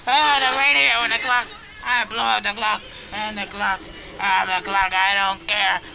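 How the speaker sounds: silly Russian villain ranting about smashing the clock; mock rage building. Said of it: "I get so angry".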